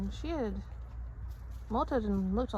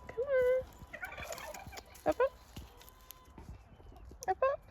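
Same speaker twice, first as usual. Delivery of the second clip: high, sing-song pet voice; 11 semitones higher than usual. Real, not acted. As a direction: coo to the pets in a high voice. Calling pets in baby talk.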